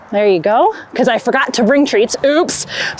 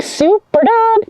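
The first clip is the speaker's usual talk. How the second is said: slow, high, playful dog voice; voicing the dog; 7 semitones higher, slower than usual. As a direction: give the dog a proud little voice. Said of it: "Then she's like".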